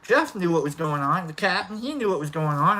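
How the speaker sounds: ordinary delivery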